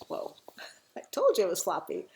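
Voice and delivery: ordinary delivery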